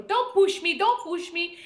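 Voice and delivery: mother holding her place, loud and high; pushy mother at concert. Retold or imitated. Mother at the concert telling people not to push, loud and high.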